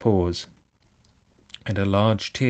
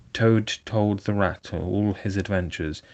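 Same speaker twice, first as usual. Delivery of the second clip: usual speaking voice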